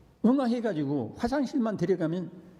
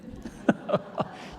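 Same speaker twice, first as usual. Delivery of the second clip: breathy laugh. Real, not acted. Preacher chuckles at his own grumpy thoughts.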